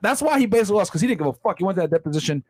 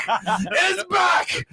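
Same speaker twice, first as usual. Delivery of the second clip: higher, shouted "It's back"; mock rally shout; 12 semitones higher than usual. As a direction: cheer it like a rally cry. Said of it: "repeated louder".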